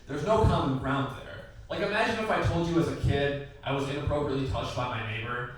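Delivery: ordinary delivery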